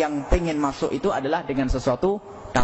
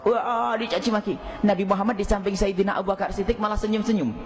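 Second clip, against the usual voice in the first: ordinary talk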